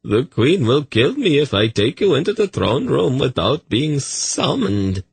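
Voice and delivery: a terrified fat eunuch refusing; frightened eunuch. Acted.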